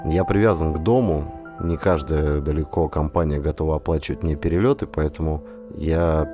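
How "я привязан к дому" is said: usual speaking voice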